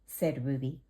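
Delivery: lively storybook read-aloud; gentle children's story narration. A narrator's reading. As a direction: read it bright and clear for little ones. Reads picture book to children.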